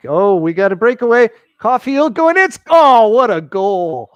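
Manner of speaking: high loud goal call; long goal yell. Real, not acted. Breakaway goal while watching.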